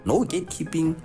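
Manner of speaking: ordinary talk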